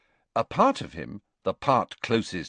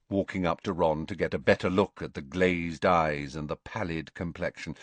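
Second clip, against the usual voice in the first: ordinary delivery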